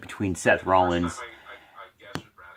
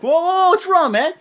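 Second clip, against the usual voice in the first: high, loud acted whoa; alarmed intervention; 7 dB louder than usual. Mick steps in on Seth backstage, imitated loud alarm. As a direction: imitate someone loudly trying to halt a frenzy. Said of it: "And then Mick comes".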